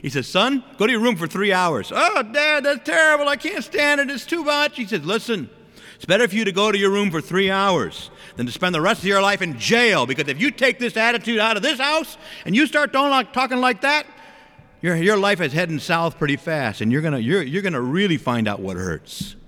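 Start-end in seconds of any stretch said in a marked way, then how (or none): 0.3-1.9 imitated dad, higher and louder; raised-voice parental order; stern father. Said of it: "my father reminded me of this". 1.9-4.8 very high, hammed-up kid's wail; mock childish protest, pitched way up; protesting son. Acting himself as punished son. 5.0-19.4 worked-up dad tirade, high then settling; raised, emphatic parental warning, easing toward the end; lecturing father. Retold or imitated. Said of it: "my dad would talk like that".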